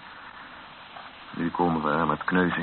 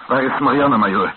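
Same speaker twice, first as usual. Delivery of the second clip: louder, pressing question; urgent demand; 5 semitones higher, 8 dB louder than usual. Acted. Rombout demanding the major tell him where Marianne is, urgent. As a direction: demand an answer at once.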